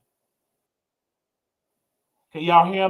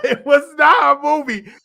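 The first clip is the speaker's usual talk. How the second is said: laughing denial; 11 semitones higher, 10 dB louder than usual. Real, not acted.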